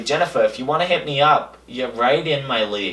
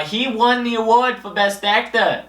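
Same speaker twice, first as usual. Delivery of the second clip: normal talking voice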